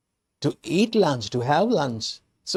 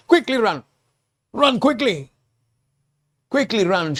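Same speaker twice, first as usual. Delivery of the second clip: urgent shout; 7 semitones higher than usual. Acted. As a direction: warn them to flee. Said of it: "shouts the town mouse".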